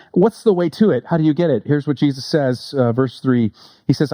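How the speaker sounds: ordinary delivery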